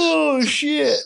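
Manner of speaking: high yawned "Oh, shit". Retold or imitated. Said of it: "It's like".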